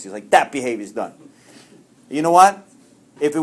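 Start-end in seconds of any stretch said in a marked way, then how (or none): 0.3-1.1 lightly voiced sponsor, low and final; low-pitched verdict, imitating the sponsor; a hint of a stern sponsor; imitated sponsor. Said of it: "That's the way he talks".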